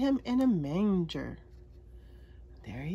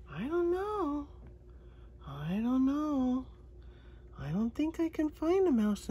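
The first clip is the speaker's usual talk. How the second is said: playfully puzzled; 7 semitones higher, 5 dB quieter than usual. Pretend.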